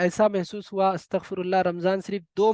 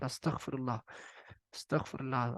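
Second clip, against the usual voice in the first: murmured much softer and lower, in awe; hushed istighfar; 6 semitones lower, 13 dB quieter than usual. Real, not acted. Preacher to the women listening, hushed and awed. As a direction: breathe the words low, shaken by the image.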